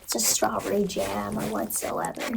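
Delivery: ordinary talk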